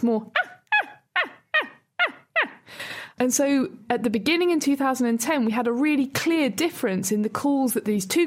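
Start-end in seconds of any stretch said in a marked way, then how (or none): 0.4-2.5 very high-pitched, short chimp yelps; high tonal grunts. Shown as a demonstration. Scientist imitating apple-loving Dutch chimps, high tonal grunts. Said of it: "much higher pitch, more tonal grunts".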